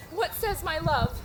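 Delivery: ordinary delivery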